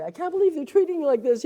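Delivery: high, loud indignant cry; upset outcry. Retold or imitated. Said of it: "you know, and say".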